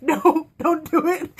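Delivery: slow, laughing plea with a catch; pleading no. Retold or imitated. Fan pleading with Alec not to do it, slow and laughing. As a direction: beg half-laughing, stretched out.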